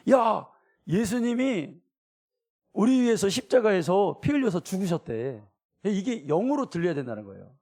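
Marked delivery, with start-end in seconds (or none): 0.1-5.4 higher and louder, pressing; preacher voicing urgent good news to the congregation; urgent announcement. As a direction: tell it like breaking news that can't wait. Urgent news of Jesus' death.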